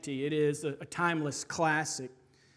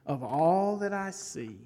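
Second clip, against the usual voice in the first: usual speaking voice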